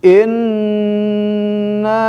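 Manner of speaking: Inna drawn out long and high, recited; fluent Quranic reciting. Shown as a demonstration. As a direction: chant Inna slowly with a held vowel.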